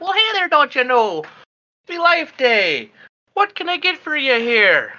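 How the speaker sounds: put-on high, folksy old lady voice, lilting; chirpy, homespun shopkeeper greeting customers. Old store lady greets the party, high and folksy. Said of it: "and she's like".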